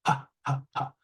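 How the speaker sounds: repeated puffed "pa"; isolated P sound. Shown as a demonstration. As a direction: demonstrate the voiceless P, separately each time. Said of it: "the P sound".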